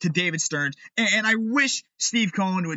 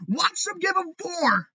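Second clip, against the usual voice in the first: high, slow yell; furious shout; 11 semitones higher, slower than usual. Real, not acted. Fan predicting the Red Sox signing, high furious yell. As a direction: bark the prediction in disgust.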